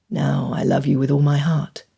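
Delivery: low tender declaration; lovingly, from the heart. A narrator's reading. Fitzwilliam declares his love.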